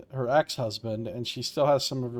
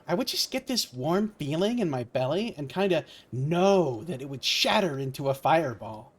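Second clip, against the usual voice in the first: ordinary talk, nothing marked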